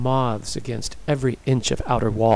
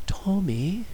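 hesitant, rising call of the name; slow, high, questioning; slowly; 8 semitones higher, 3 dB quieter than usual. A narrator's reading. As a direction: draw the name out as an idea dawns. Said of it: "she said slowly".

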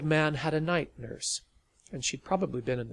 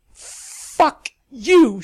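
loud, high and final; firm; 12 semitones higher, 17 dB louder than usual. A narrator's reading. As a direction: spit it out and storm off. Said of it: "she said firmly".

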